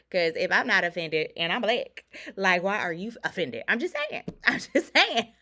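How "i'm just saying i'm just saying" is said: cheeky high repeat through a laugh. Real, not acted. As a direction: say it twice, laughing.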